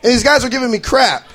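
normal talking voice

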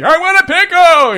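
high, loud demand; hammed-up old-man tantrum; whining old grandpa. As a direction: holler it like a stubborn grandpa. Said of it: "He's like".

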